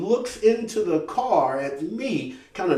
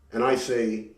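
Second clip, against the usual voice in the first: ordinary talk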